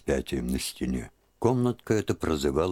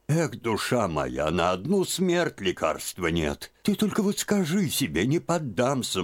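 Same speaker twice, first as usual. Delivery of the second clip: put-on comforting old aunt; 7 semitones higher than usual. A narrator's reading. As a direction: soothe her like a grandmother. (тётка утешает Лизу, ласково и высоко)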